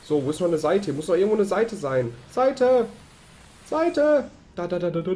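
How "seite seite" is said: calling out. Real, not acted. Calling out for missing page.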